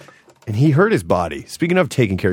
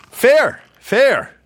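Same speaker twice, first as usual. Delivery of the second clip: hyped-up name call; 12 semitones higher, 5 dB louder than usual. Acted. Host hypes guest name.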